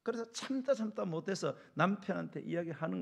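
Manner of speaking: loud, high, drawn-out preaching; impassioned pulpit delivery, slow and ringing; full-force preaching. Real, not acted. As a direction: drive each phrase home to the congregation.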